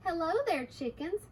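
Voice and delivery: lightly lifted, sing-song hello; little girl's greeting. Acted. Little girl greets the two chickens, lightly voiced. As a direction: greet them with a pitch lift.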